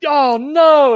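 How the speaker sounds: startled alarm. Retold or imitated. Retelling hearing the life jacket pop, alarmed. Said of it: "I was like".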